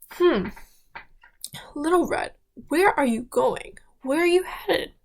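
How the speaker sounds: a hammed-up sly wolf sniffing out his prey. Wolf questions Little Red.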